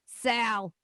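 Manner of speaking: loud one-word goblin introduction; goblin popping up. Acted. Goblin introducing himself to newcomers, abrupt. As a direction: pop up and announce the name. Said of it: "the goblin pops up and says".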